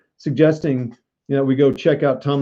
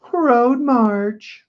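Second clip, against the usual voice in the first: "Road march" squeaked out much higher, drawn out; high sing-song "Road march"; 10 semitones higher than usual. Shown as a demonstration. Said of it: "can anyone say".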